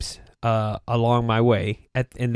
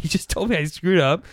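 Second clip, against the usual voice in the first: ordinary talk, nothing marked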